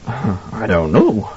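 slow, weak reply; weak, dazed. A narrator's reading. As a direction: drag it out weakly, as if dazed. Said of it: "laughed weakly".

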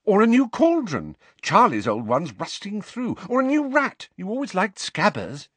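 high, excited doting mother; gushing mother. A narrator's reading. Mother offering her son a reward, gushing in a high voice.

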